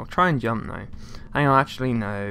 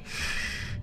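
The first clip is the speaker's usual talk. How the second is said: shush; 9 dB quieter than usual. Real, not acted. Shushing himself while sneaking.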